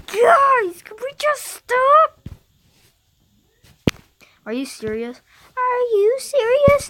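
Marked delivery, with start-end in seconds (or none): 0.1-2.3 high, loud pleading cry to the others; pleading yell. Acted. State kid yelling at the other states to stop. 5.6-6.9 much higher and louder shouted question. Acted. State kid shrieking at the others in disbelief. Repeated line, plain vs shouted.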